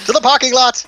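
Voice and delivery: playful charge cry; mock rallying cry. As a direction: echo the hero's call, high and loud.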